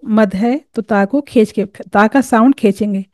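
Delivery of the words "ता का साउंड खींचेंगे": ordinary talk, nothing marked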